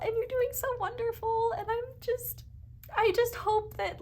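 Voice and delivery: proud and choked up, holding back tears; tearing up. Real, not acted. Reader tearing up telling viewers she's proud, tearful. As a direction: voice high and tearful, close to crying. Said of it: "I don't know why I'm getting emotional".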